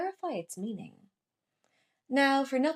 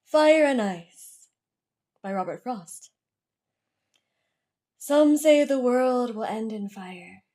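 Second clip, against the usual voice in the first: poem reading; 5 dB louder than usual. Acted. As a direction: recite verse with deliberate pauses between lines. Poem read aloud after introduction.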